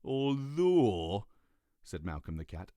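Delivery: a cat letting them off; lightly voiced cat. Malcolm voiced.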